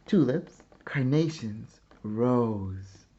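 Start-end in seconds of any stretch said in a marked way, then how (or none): none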